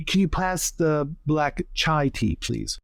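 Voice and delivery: ordinary talk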